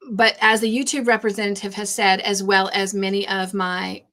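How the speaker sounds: normal talking voice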